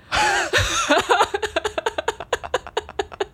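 high-pitched burst of laughter; long high laugh. Real, not acted. Long laugh after her story.

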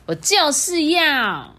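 slow, high stubborn insistence. A narrator's reading. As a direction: dig in your heels, drawn out.

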